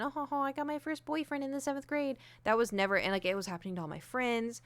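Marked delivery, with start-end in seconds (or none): 0.0-2.2 high, breathy mock girly brag; giddy bragging girl.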